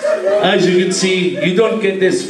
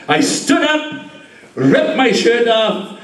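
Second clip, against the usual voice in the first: normal talking voice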